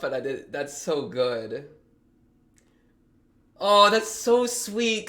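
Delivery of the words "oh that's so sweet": slow, higher, louder gushing awe. Real, not acted. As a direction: melt at how sweet it is. Gushing over a sweet scene.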